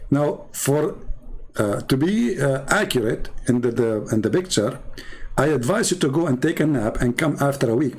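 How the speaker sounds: ordinary talk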